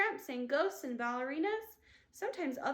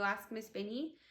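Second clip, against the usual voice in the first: ordinary delivery, nothing marked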